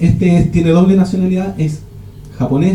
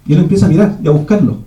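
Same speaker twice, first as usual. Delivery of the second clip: usual speaking voice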